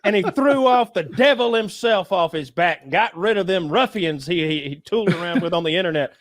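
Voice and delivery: mock Southern dad. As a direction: brag about the son like a folksy Southern preacher dad. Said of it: "I don't know why your dad's from the South now".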